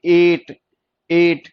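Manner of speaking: repeating to show length; long e. Shown as a demonstration. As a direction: hold the ए of एट. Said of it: "long जा रही थी".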